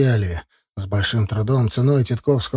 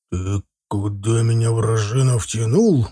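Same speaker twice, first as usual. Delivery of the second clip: angry hiss; 2 semitones lower, slower than usual. A narrator's reading. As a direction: hiss it slowly in a character voice. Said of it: "прошипел Захар".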